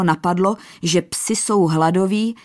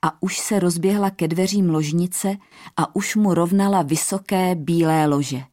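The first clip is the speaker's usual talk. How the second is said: ordinary delivery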